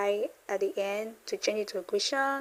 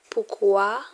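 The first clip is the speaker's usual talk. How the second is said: ordinary talk, nothing marked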